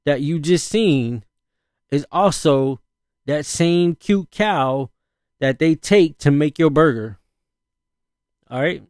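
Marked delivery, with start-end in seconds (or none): 3.3-4.9 very slow, stretched cute cow; slow pointed emphasis; emphatic slow. Acted. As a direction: drag out the words. Repeating point slowly.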